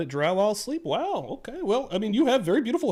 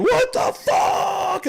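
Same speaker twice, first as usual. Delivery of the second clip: high-pitched, drawn-out mock scream; long, high yell of disbelief, played back; retold scream; 13 semitones higher, 3 dB louder, much slower than usual. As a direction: re-enact a screamed reaction, stretching the words out. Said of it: "I just started screaming".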